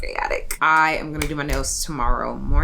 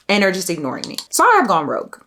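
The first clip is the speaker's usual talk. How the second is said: ordinary talk, nothing marked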